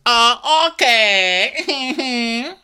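high loud sulky uh okay; snotty drawn-out okay. Retold or imitated. Joanne to his boss, high loud and sulky. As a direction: drag it out, sulking. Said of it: "He goes".

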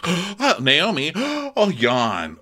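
high gasping mock nonchalance; fake casual gasp. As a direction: ham up a fake yawn. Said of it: "faux yawn thing".

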